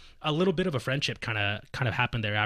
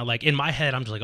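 usual speaking voice

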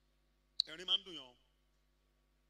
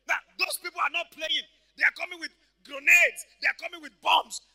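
high, shouted warning; loud, emphatic urgency; alarmed preaching; 7 semitones higher, 19 dB louder than usual. Real, not acted. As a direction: shout the danger to the congregation. Preacher warns of the armed enemy.

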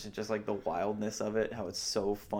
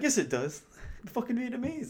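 higher, mock-indignant retort; hammed-up argumentative insistence; insistent comeback; 13 semitones higher than usual. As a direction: hit back insistently, imitating an argument.

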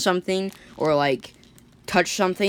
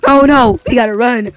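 panicked shout; 7 semitones higher, 11 dB louder than usual. Acted. Guards flee the monster.

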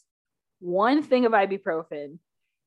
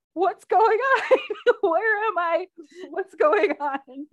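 high, loud, drawn-out bewildered wail; acted-out panic with a laugh; retold scared confusion; 8 semitones higher, 5 dB louder, slower than usual. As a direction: wail it slowly like someone lost. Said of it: "and I'm scared".

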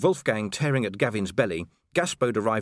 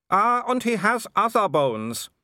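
slow, higher, relishing the threat; gloating werewolf; 11 semitones higher, 4 dB louder than usual. A narrator's reading. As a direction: savour every word like a cruel joke. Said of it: "said Wolfgang".

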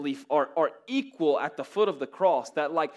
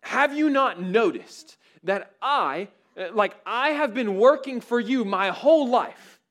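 aggrieved son; 8 semitones higher, 7 dB louder than usual. Retold or imitated. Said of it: "He's like".

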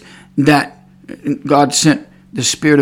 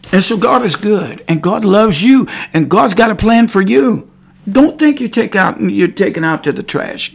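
higher, louder impassioned sermon delivery; fervent preaching; 5 semitones higher, 4 dB louder than usual. Real, not acted. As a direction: preach it out to the congregation with fire. Preaching at full force after praise.

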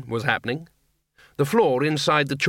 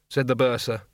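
plain reading voice

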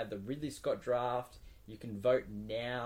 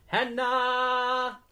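much higher, loud host call-out; hype introduction; 14 semitones higher, 12 dB louder than usual. Acted. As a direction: announce her like a game-show star.

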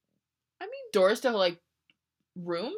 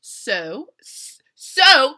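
a burst of loud, higher so; caps shout; 7 semitones higher, 14 dB louder, much slower than usual. Shown as a demonstration. Reading her fanfic, she shouts the all-caps so. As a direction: read the caps so loud and high. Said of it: "so" is in all caps".

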